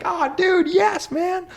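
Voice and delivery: high excited cheer; excited friends. Retold or imitated. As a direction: whoop it with a friend. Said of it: "being like".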